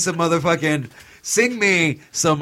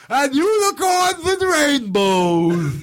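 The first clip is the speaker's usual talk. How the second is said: high, slow shouted clown voice; sing-song it like a goofy clown; silly clown; 9 semitones higher, much slower than usual. Pretend. Joining clown voice.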